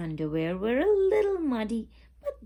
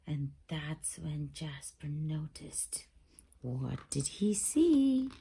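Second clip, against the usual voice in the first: suspense whisper; 5 semitones lower than usual. A narrator's reading. Narrator hushing children before the reveal, whispered. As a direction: lean in and breathe the question. Suspense in spooky bedtime story.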